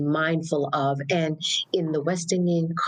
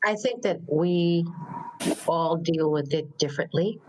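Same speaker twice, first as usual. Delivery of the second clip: usual speaking voice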